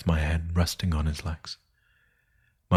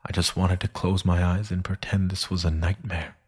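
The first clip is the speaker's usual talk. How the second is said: plain reading voice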